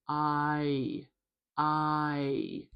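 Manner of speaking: "I" stretched so both vowels are heard; drawn-out "I", both halves sounded; two-part diphthong. Shown as a demonstration. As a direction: glide slowly from "ah" to "ee" in "I". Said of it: "There's two parts".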